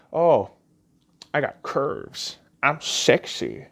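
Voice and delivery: slow, mock-sultry boast; lightly voiced smug self-praise; mocking fat people. Mocking people who call themselves sexy, slow smug voice. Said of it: "those fat people that tell you".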